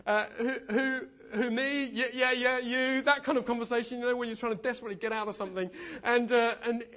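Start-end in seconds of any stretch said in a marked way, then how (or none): 0.4-3.0 high laughing who-me voice; mock awkward back-and-forth; reluctant dodge. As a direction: play the person trying to get out of it, then the insistent reply. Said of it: "one of those conversations".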